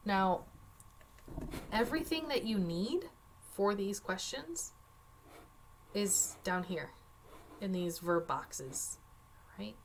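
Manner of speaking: ordinary delivery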